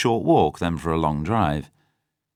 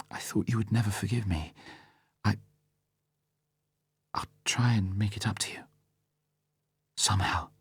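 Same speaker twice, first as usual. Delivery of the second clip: whispered, grateful, still crying; tearful whisper; 8 dB quieter than usual. A narrator's reading. Vesper promising Bond amends, whispered through tears. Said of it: "through her tears".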